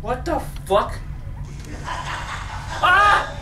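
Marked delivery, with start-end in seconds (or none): 1.8-3.3 high startled cry; frightened scream. Real, not acted. Player startled in the dark, high cry. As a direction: cry out at a jolt. Jump scare in game.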